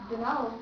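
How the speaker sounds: "Don't know" run together as dunno; T dropped. Shown as a demonstration. Teacher shows what we say, T dropped. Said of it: "we say".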